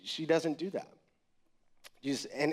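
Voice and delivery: ordinary talk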